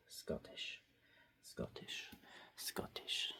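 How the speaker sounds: breathy whisper, barely audible; hushed repeated whisper; whispered Scottish. Real, not acted. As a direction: whisper the word to yourself. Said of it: "Why am I saying Scottish now".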